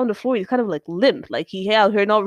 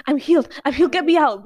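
acting out the wife; 7 semitones higher, 6 dB louder than usual. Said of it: "She's like".

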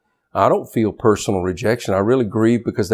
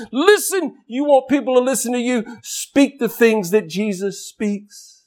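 impassioned plea; 11 semitones higher than usual. Real, not acted. Preacher pleading with congregation.

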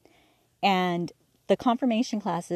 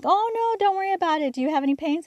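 higher, louder brushing-off mom voice; dismissive mom; 10 semitones higher, 4 dB louder, faster than usual. Retold or imitated. Mother brushing off daughter's broken water, high and dismissive. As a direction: mom shooing away the worry.

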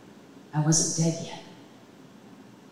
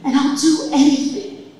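desperate prayer; 6 semitones higher, 5 dB louder than usual. Retold or imitated. Praying to God for her life.

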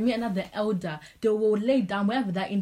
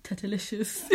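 ordinary talk

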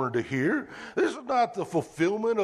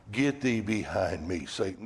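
stern rebuke; 9 semitones lower, 3 dB quieter than usual. Retold or imitated. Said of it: "sternest or the harshest words".

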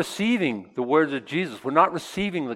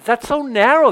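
higher, louder protest; higher, louder mock complaint; complaining; 10 semitones higher, 7 dB louder than usual. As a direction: whine the objection. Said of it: "You're saying".

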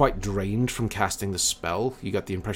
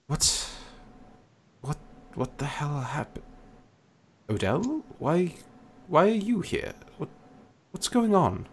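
halting, higher-pitched confusion after a sigh; groggy, bewildered waking; 8 semitones higher than usual. Acted. Lord waking in bed asks Odell why he is here, bewildered. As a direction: come round confused, stumbling over the questions. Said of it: "kinda, like, shaking the sleep off".

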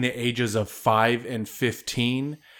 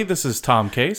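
normal talking voice

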